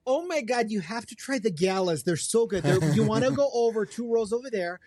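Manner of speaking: high, gushing Wisconsin apple picker; Wisconsin local accent. Retold or imitated. Local recommending galas at the orchard, high Wisconsin accent. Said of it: "it was kind of like that".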